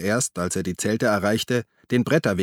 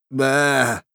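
higher, revolted groan; disgusted; 4 semitones higher, 4 dB louder than usual. A narrator's reading. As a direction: spit out your revulsion. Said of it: "Grabbes angewiderte Stimme".

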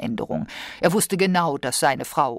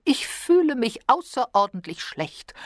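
usual speaking voice

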